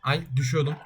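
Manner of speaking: normal talking voice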